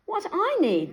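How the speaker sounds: high, eager wolf voice. A narrator's reading. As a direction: voice the wolf thinking aloud. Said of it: "the wolf thought to himself".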